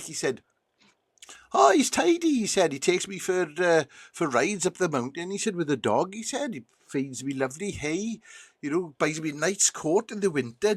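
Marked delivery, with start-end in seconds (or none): 1.5-10.7 lightly voiced horse, higher pitched; approving talking horse. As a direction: praise the owner in a higher horse voice. Horse praises the farmer in joke.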